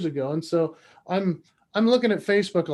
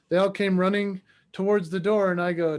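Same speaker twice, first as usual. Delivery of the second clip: normal talking voice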